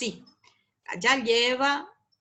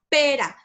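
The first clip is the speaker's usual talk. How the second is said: "pera" with PE punched louder, ra dropping; stress on the first syllable; 3 semitones higher, 7 dB louder than usual. Shown as a demonstration. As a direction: lean the voice on the "pe" of "pera". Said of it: "la P en la E se carga la voz".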